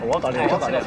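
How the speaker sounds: usual speaking voice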